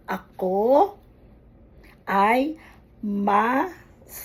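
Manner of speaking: "ako ay masaya" spaced out slowly with long pauses and rising ends; slow, syllable by syllable. Shown as a demonstration. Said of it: "either make it slowly".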